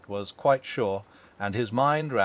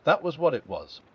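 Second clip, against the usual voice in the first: plain reading voice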